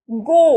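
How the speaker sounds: গো with a long, held vowel; long O sound. Shown as a demonstration. Teacher showing students the British O, long vowel in গো. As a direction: stretch the vowel in গো. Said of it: "long sound আসছে না, short তো আসছে না".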